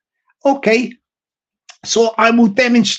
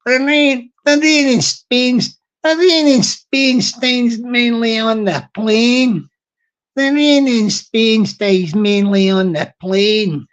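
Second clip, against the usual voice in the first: put-on slow, broad Glaswegian accent; little Glasgow guy; slower than usual. The little Glasgow guy reciting the experiment line, broad Glaswegian. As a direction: draw it out like a Glasgow wee guy. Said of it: "the little Glasgow guy would say".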